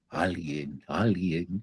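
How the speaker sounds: hushed low echo fading away; faint echo. Acted. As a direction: play the canyon echoing back. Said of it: "el eco le responde".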